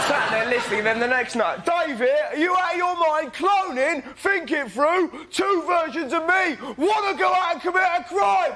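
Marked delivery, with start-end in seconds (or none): 1.6-8.5 high, drawn-out outraged shouting; ranting nutter caller. Retold or imitated. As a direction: rant like an indignant caller, voice way up. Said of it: "listening to these nutters".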